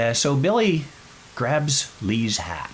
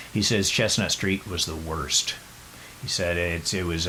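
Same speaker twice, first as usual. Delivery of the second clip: normal talking voice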